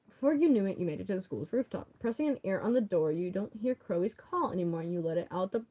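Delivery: normal talking voice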